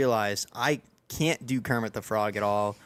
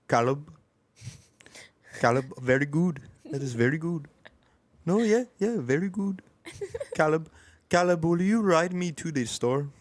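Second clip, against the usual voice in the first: put-on higher voice, heavy Italian accent in broken English; 7 semitones higher than usual. Ski teammate Gio praising Caleb, Italian accent. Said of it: "impression of him speaking poor English".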